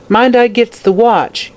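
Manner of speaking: high slow yell from a corner. A narrator's reading. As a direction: call it out across the room. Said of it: "yelled Lizer from the corner".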